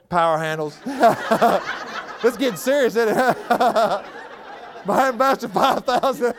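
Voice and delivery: rising, laughing list of lost gear; laughing while listing. Real, not acted. Preacher laughing as he lists the gear he gave away.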